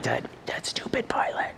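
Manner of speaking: hushed, whispered grumble; angry muttering. Retold or imitated. Paul hypothetically blaming the pilot, angry whispered mutter. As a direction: seethe under the breath. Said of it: "He could have fed off the anger".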